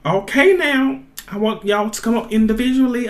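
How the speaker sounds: ordinary talk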